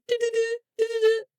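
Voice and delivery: high, sung-out video-call ringing; imitated call tone. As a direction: mimic a phone's electronic dial tone. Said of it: "what do I hear? It's like".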